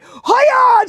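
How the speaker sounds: high, loud horse-driving shout; heroic little rider. Retold or imitated. Little friend riding out to the rescue, mock heroic yell. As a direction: shout it like a tiny movie hero. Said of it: "she was my hero".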